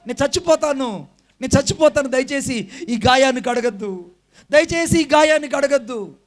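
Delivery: retold yell of a patient whose wound is being washed; screaming in pain. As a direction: beg the doctor to stop, crying out in pain. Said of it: "నేను అరుస్తున్నాను".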